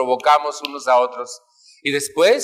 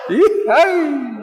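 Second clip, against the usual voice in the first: high mock sigh; 12 semitones higher, 4 dB louder than usual. Mock sigh after father story.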